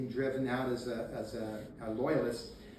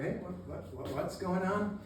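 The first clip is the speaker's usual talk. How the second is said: higher, stumbling waking-up voice; groggy confusion; 4 semitones higher, 4 dB quieter than usual. Retold or imitated. Rangers on the hill waking up, mock bewilderment. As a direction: act just woken and bewildered.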